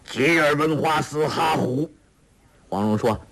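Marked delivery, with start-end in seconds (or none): 0.1-1.9 chant-like foreign syllables, evenly held; reading out scripture. A narrator's reading. Villain eagerly reading the next garbled line, in recitation. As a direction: drone the strange words off a page. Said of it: "又念".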